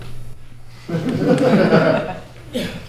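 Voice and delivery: low, breathy belly laugh; hearty chuckle. Acted. Neighbour laughing at visitor.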